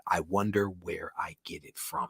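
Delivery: unvoiced joking whisper; a soft whispered joke to yourself; whispered aside. Real, not acted. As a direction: murmur it under your breath as a sly aside. Joking aside about own chattiness.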